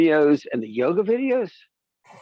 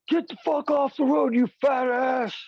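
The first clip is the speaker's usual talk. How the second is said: angry trucker yelling; 9 semitones higher than usual. Retold or imitated. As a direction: bark it like a road-raging driver. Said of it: "the driver goes".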